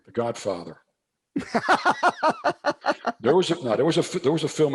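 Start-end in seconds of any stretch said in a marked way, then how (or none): 1.3-3.1 real hearty laugh. Real, not acted. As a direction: break up laughing. Laughs after naming film.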